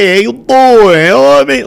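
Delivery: high, cocky wise-guy greeting; gangster strut voice. Retold or imitated. Guy fresh out of a gangster movie, puffed up. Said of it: "and you're like".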